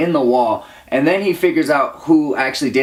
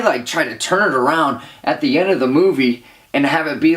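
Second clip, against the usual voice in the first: ordinary talk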